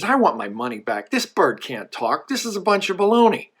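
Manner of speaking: mock-angry customer complaint; really angry, acted. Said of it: "Now he's really angry".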